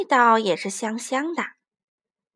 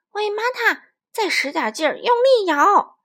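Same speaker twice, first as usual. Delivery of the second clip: urging call; 8 semitones higher, 7 dB louder than usual. Acted. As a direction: urge harder shaking. Child urging friend to shake.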